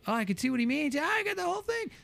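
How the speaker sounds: convinced customer. Retold or imitated. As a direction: gush like you finally get it. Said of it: "You'd be like".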